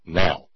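"now" set apart and hit firmly; "now" said alone, pointedly stressed; emphasis on now. Shown as a demonstration. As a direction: land hard on the single word "now". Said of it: "Emphasis on the word".

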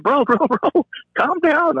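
high-pitched retold plea; urgent calming appeal. Officer to kicking detainee, urgent high appeal.

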